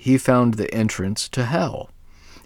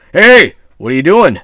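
very loud, higher angry scream; screamed angrily; 8 semitones higher, 16 dB louder than usual. A narrator's reading. The woken devil screams at grandmother, very loud. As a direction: scream it in outrage. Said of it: "The devil screamed angrily".

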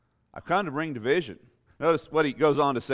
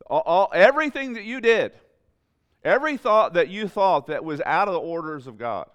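much higher and louder, almost a shout; full-force preaching; 7 semitones higher, 6 dB louder than usual. Acted. Preacher telling the congregation to light it on fire, shouting. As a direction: cry it out like fire from the pulpit.

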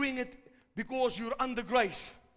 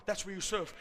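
usual speaking voice

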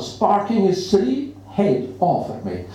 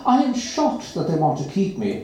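usual speaking voice

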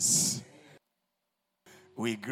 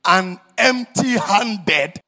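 pleading sermon shout; 11 semitones higher, 13 dB louder than usual. Acted. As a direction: cry out the dying man's question. Preaching at full force.

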